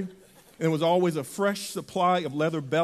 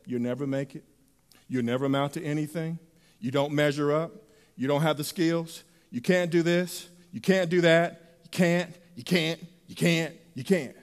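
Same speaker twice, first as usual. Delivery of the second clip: mocking critics; 5 semitones lower, 4 dB quieter than usual. Critics tearing people down.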